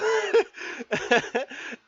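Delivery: loud high burst of laughter; hearty laugh. Real, not acted. Laughing at Rick's hippie line, loud real laugh. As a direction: crack up at your own joke. Laughs at own retold joke.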